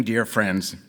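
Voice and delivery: usual speaking voice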